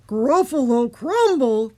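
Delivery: loud, slow, aghast monster voice; Gruffalo voice. A narrator's reading. Gruffalo echoes mouse's threat, loud slow monster voice. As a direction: echo it as a big shocked beast. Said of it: "the Gruffalo said".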